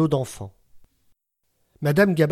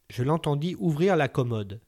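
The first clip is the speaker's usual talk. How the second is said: ordinary delivery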